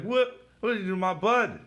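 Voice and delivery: high, loud indignant demand; outraged character. Retold or imitated. Deadpool demanding answers for his buddy, high and loud. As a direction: voice Deadpool confronting the killer. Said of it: "so he's like".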